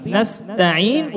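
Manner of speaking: "nasta'in" slowly, with light fronted "ta". Shown as a demonstration. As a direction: stretch nasta'in, tongue down on ta. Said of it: "posisi lidah turun". (nasta'in tipis, lidah turun)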